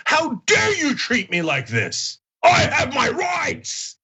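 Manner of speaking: slow, wailing indignation, rising to a high cry; hammed-up imitation of an outraged right-winger; mock enraged. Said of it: "they would be enraged".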